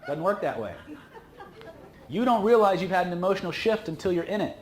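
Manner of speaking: ordinary delivery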